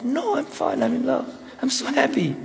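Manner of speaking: high, giddy lovestruck gush; giddy lover. Retold or imitated. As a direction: gush it like you're floating on cloud nine. Lover brushing off heat and misery.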